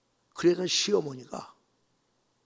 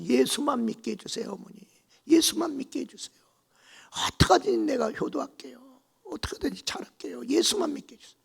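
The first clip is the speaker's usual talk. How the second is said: higher tearful begging; pleading in pain; 11 semitones higher than usual. Retold or imitated. Bride begging while being burned, desperately.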